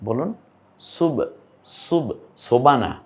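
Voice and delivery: normal talking voice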